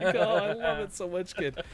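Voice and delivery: high, loud and thrilled; gushing delight. Retold or imitated. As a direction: gush over the kid. Gushing over his son.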